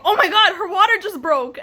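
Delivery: high, loud imitation of a panicking husband; retold panic. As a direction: mimic the husband's alarm, pitched right up.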